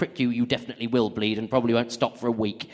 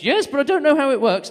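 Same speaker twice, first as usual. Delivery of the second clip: much higher, louder fretful mum; old mum voice; 12 semitones higher, 7 dB louder than usual. Retold or imitated. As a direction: whine it like a confused mum.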